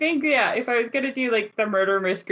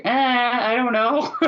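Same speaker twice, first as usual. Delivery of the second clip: drawn-out, higher-pitched mock indecision; analysis paralysis; 4 semitones higher, 4 dB louder, slower than usual. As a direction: dither slowly, imitating a stumped player. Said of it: "the analysis paralysis and just like".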